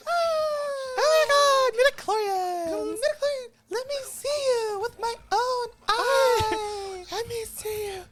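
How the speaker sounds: very high, loud, slow, whimpering Vader; squeaky Darth Vader. Pretend. Recasting Darth Vader in the ridiculous voice, squeaky and whimpering. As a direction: play Vader as a sobbing squeaky toy. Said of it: "With what we've been doing".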